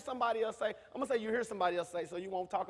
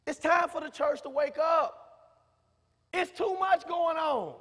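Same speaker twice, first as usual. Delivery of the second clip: preaching at full force, louder, rising to shouts; 6 semitones higher, 8 dB louder than usual. Acted. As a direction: rouse the congregation, building to a cry. Preacher exhorting congregation.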